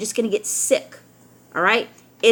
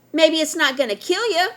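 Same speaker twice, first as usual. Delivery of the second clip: usual speaking voice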